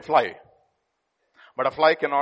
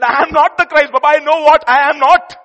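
loud, high declaration; emphatic proclamation; 10 semitones higher, 16 dB louder than usual. Real, not acted. Preacher proclaiming John's words, loud and forceful.

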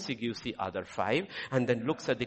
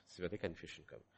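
much softer, lower repeat, almost to himself; hushed echo; 9 semitones lower, 17 dB quieter, faster than usual. Real, not acted. Preacher to congregation, hushed repeat. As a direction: let it drop to a murmur.

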